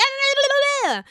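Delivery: high-pitched mocking gibberish; squealed imitation of an overreacting rant; Jennifer Aydin. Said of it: "after Jennifer's saying all this shit".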